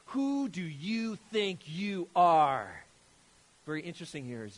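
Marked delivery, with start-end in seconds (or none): 0.1-2.8 loud, slow, drawn-out demand; mock outrage, stretching every word; acted indignant challenge. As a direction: challenge him like an affronted accuser. Acted challenge from angry opponents.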